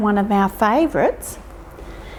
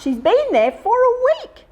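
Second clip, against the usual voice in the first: squeaky mouse; 13 semitones higher than usual. Acted.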